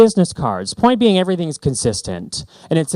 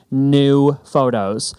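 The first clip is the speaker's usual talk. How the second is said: NEW drawn out slowly, clearly apart from photos; deliberately slow; much slower than usual. Shown as a demonstration. Designer telling clients to go do new photos, slowly. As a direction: stretch out "new" so it can't blur into "photos". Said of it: "I have to say that slowly".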